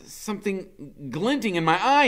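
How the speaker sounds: ordinary delivery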